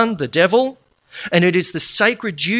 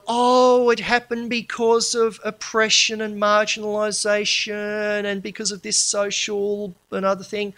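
high, drawn-out mock-pundit whine; mocking media experts; 5 semitones higher than usual. Lecturer mimicking media experts on Bali bombing, mocking. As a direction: parrot the experts in a high, slow monotone. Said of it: "you had all the experts".

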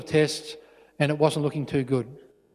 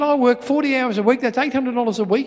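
higher, mildly indignant dad; lightly acted fatherly protest; exasperated father; 7 semitones higher than usual. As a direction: justify yourself to your child, pitched up. Father refusing son's request for money.

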